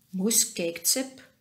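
usual speaking voice

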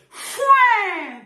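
loud contemptuous spitting noise; scornful spit sound. Real, not acted.

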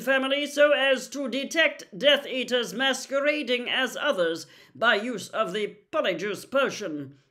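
loud, formal bulletin read with a shout on the warnings; stern government leaflet read aloud; official public notice. Acted. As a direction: proclaim it like a wartime safety announcement. Reading out the ministry leaflet.

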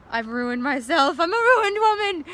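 rising to a high, loud hammed-up wail; mock despair. Speaker waking after the drunken meal, hammed-up wailing. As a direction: play the morning-after ruin to the hilt.

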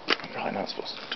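hushed guilty whisper. Real, not acted. Sneaking a peek at Grandma's pudding, a guilty whisper.

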